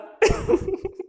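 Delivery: high-pitched real laughter; tickled, squealing little laugh; giggle. Real, not acted. Cracking up at Hulk Hogan.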